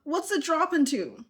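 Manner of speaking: high, incredulous scolding; exasperated. Real, not acted. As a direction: tease her like a friend who did something silly. Scolding a clumsy demo.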